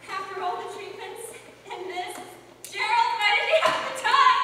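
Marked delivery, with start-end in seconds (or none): 2.7-4.4 loud, higher anguished wail; grieving cry. Acted. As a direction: cry out in grief. Widow grieving at funeral.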